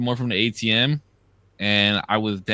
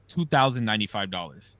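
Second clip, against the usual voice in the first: ordinary talk, nothing marked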